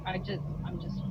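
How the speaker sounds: ordinary talk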